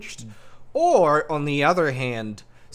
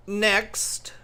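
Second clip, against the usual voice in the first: high, ringing call for the next one; loud announcement; 9 semitones higher than usual. Acted. Archmage summons the next petitioner, loudly announcing. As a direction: archmage calling out to the queue. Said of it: "The Archmage loudly announces".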